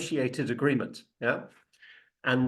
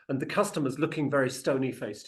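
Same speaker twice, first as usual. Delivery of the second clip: ordinary delivery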